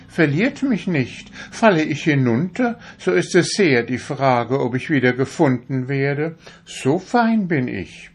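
high, proud little needle voice; a needle showing off how fine it is; vain darning needle. A narrator's reading. To the fingers, a vain needle in a high proud voice. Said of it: "sagte die Stopfnadel zu den Fingern".

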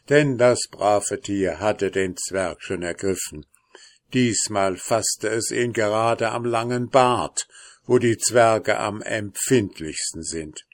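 ordinary delivery

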